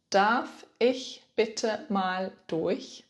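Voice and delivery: very slow, deliberate repeat. Shown as a demonstration. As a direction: stretch every word for the learner. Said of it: "In German, you can say".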